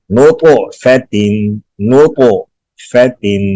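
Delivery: "نطفتن" slowly, with a bounce on the stopped consonant; qalqalah demonstratie. Shown as a demonstration. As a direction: recite "نطفتن" drawn out, echoing the first stop. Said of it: "قاف پہ قلقلہ کریں گے".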